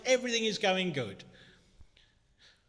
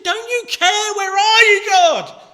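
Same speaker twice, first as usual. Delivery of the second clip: much higher, much louder anguished yell at God; desperate shout; 17 dB louder than usual. Retold or imitated. A grieving believer shouting at God, anguished yell. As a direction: hurl it at the sky in despair. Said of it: "we can be shouting".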